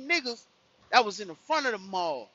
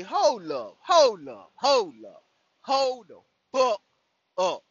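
louder, rising outrage, hold up over and over; 8 dB louder, slower than usual. Retold or imitated. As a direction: stop everything in loud disbelief. Shocked, demanding a stop.

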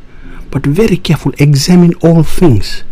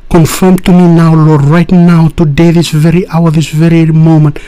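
pleading at full force, pitch raised; 3 semitones higher than usual. Real, not acted.

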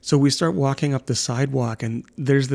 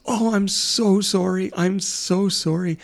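higher, slow, gushing apology; apologetic man; 6 semitones higher than usual. Retold or imitated. Neighbor apologizing to the frightened toddler, higher and slow. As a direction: plead sorry to the scared little girl. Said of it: "and he goes".

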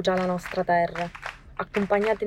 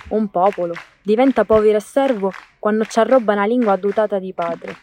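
solemn declaimed poem, building louder; poem recitation; 5 dB louder than usual. Acted. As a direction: recite it like a proud poet. (recitazione solenne di una poesia)

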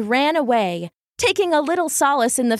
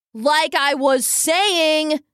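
slow, deliberate raised voice; boy shouting over the crowd; mock scream; 5 semitones higher, much slower than usual. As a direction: call it over the panicking crowd. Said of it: "screamed Randy".

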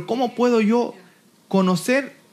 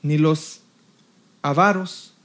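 slow, lower-pitched Bible recitation; measured, low scripture delivery; scripture reading; 4 semitones lower, slower than usual. Acted. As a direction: read the list deliberately, pausing between items. Resumes reading the scripture list.

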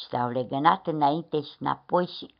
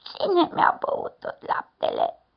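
put-on high, slow piping baby bear; little cub in a squeaky voice; 11 semitones higher than usual. A narrator's reading. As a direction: pipe it up like a tiny cub. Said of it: "cu o voce pițicăiată".

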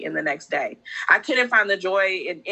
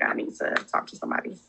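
hushed realization; 4 dB quieter, faster than usual. Retold or imitated. Retold moment of realizing need.

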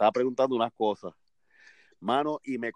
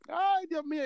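mock giggly exclaim, pitched way up; imitating her laughing. Church usher laughing at his mistaken voice message. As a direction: squeak it out like a woman laughing at a mix-up. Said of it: "Y era riéndose".

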